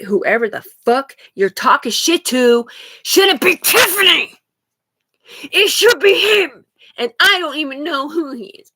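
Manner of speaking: loud, high-pitched real outburst; angry outburst. Real, not acted. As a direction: lash out loudly at the accusers. Angry rant at unseen accusers.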